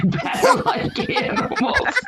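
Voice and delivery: high-pitched, words breaking into laughter; laughing through it. Real, not acted. Joking to friends about dating site rules, laughing. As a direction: crack up while saying it.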